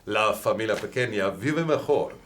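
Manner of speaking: put-on reciting Spanish phrases in a Spanish accent. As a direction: rattle off stock Spanish lines with an accent. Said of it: "You even say it with a Spanish accent".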